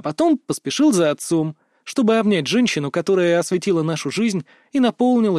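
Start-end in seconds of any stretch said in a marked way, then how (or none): none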